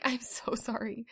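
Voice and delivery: soft, slow apology; tender apology. Retold or imitated. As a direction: say it gently to the child. Apology to son.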